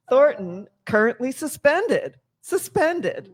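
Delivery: excited. Acted. Gleeful emphasis reading scandal.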